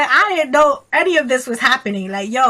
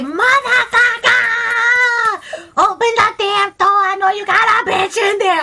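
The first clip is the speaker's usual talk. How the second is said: high screamed tirade, first word drawn way out; psycho rage; 11 semitones higher, 4 dB louder than usual. Retold or imitated. Friend acting out woman at ex-boyfriend's door, furious screaming. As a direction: yell it like you're kicking and punching the door. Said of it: "get all psycho and shit".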